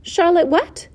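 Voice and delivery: high, eager query; eager question. A narrator's reading. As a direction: ask keenly, pitched right up. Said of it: "asked Wilbur eagerly".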